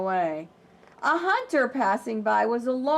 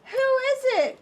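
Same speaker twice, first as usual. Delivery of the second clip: much higher, louder granny call; old granny calling; 5 dB louder than usual. Acted. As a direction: call out to the door like a grandma. Granny calls at the door.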